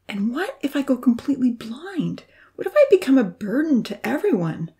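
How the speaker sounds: bitter, anxious whispered questions. A narrator's reading. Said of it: "explained rather bitterly".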